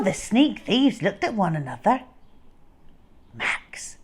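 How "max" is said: soft unvoiced whisper; scared hushed whisper; very quiet whisper. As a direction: breathe the name barely audible. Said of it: "said the first one very quietly".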